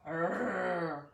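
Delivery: low frustrated growl; mother's growl. Acted. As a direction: growl in exasperation. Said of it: "growled their mother".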